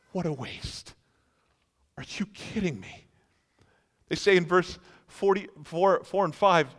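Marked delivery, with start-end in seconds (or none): 0.1-3.1 appalled disbelief. Acted. Disciples' disapproving thoughts voiced.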